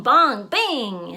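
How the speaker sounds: ordinary delivery, nothing marked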